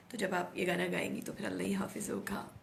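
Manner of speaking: soft joking whisper. Real, not acted. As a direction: breathe it out as a joke. Jokes about her singing voice. (हल्की फुसफुसाहट में मज़ाक)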